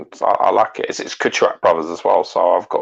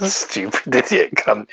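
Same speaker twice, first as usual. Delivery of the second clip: laughing insult; 5 semitones higher, slower than usual. Real, not acted. Laughing at the punchline.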